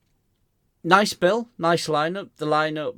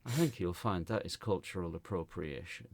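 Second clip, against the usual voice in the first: softer, lower, self-satisfied correction; smug; 6 semitones lower, 8 dB quieter than usual. Retold or imitated. Comic retelling his retort to offended woman, smug. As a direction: savour the point smugly. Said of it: "so smug".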